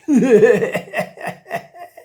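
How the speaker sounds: loud mischievous shark laugh; sly chuckle. Acted. Shark laughing to himself over Angelfish, a loud sly chuckle. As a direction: laugh like a shark who knows she is dinner.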